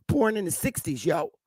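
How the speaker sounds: usual speaking voice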